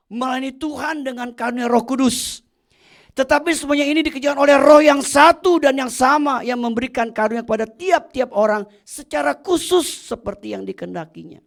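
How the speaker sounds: loud preached reading; preaching at full force; scripture proclaimed. Acted. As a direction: proclaim the verse boldly.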